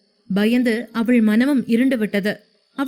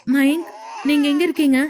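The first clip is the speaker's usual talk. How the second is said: frightened call; 3 semitones higher than usual. A narrator's reading. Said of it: "என்று பயந்து கொண்டே கேட்டாள்".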